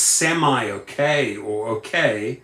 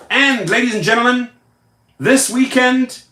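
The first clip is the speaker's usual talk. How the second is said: pitched-up hype with the last words stretched; showman's announcement; 9 semitones higher, 4 dB louder than usual. Real, not acted. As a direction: announce it like a big reveal. Announces a celebration to viewers.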